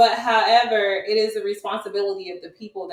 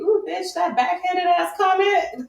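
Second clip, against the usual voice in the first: high, sarcastic mom voice; 10 semitones higher than usual. Retold or imitated. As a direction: play the mom's mock praise, pitched up. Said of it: "she's very sarcastic too".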